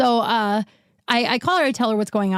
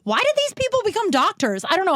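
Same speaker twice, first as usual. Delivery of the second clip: much higher, outraged rhetorical cry; furious rant pitched way up; exasperated outburst; 10 semitones higher than usual. Real, not acted. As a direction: vent the anger at doctors. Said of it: "I'm still so mad".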